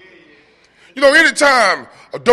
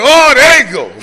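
mock exclamation; 8 semitones higher, 9 dB louder than usual. Spots dope fiends outside.

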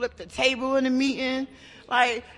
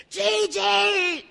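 calling her name out, higher and louder; retold yell; 6 semitones higher, 5 dB louder than usual. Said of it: "Khalees used to yell at me".

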